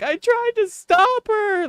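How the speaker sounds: high, slow desperate plea; pleading. Retold or imitated. Owner pleading with the vet, high and slow.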